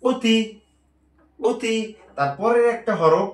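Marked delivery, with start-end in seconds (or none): 0.0-1.8 উতি said high as one blended word; teacher modelling উতি, pitched up; blended reading. Shown as a demonstration. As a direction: join উতি together, pitched up. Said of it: "দুইটা একসাথে করে দিব".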